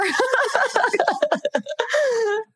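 high laughing cry. Real, not acted.

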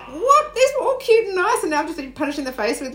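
high-pitched mock outrage, loud at first; retold outraged shock. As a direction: squeal in disbelief, then tumble on fast. Retelling shocked reaction to the clip.

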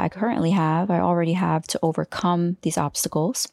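ordinary talk, nothing marked

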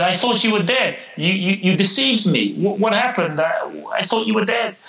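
high, disbelieving cry up at the tree; astonished. Acted. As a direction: pitch it up, stunned and accusing. Man sees dead bird alive.

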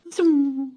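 high, loud zoom of a speeding car; zoom sound effect. Retold or imitated. Describing the Ferrari behind the ambulance, a loud high zoom. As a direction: fling the word out like a car racing by.

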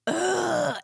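short exasperated groan; a fed-up ugh; annoyed ugh. Retold or imitated. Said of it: "And I make this, "Ugh," sound".

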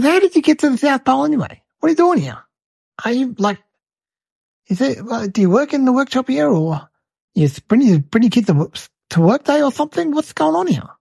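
high-pitched, bewildered questioning; puzzled interrogation. Retold or imitated. Santa quizzing a lady at the North Pole, bewildered.

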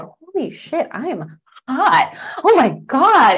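high, breathless wow; amazed delight. Retold or imitated. Host retelling watching her dance video, amazed. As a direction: marvel at your own hotness.

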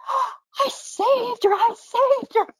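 hammed-up, high-pitched jubilant husband; imitating excited. Imitating a brother-in-law after the newborn breathes, hugely excited. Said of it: "He was so excited".

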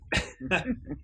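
a short, high, real laugh; high breathy laugh. Real, not acted. Telling the iced tea story, laughing. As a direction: laugh at the absurdity.

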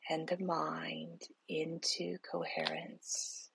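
slow, soft whisper, guiding each breath; whispered guided meditation. Acted. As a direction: breathe the words out slowly, barely voiced.

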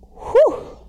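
high breathy whew of relief after a long stretch of talking; winded from so much talking, a loud high whew; tired whew. Real, not acted. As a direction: blow out a big exaggerated whew. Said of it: "it's a lot of talking".

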